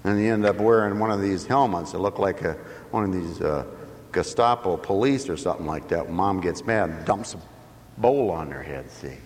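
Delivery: ordinary delivery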